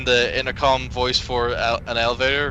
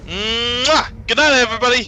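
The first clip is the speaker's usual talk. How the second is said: high, loud wailing farewell; dramatic cry; 9 semitones higher, 6 dB louder than usual. Pretend. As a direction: cry it out as a goodbye.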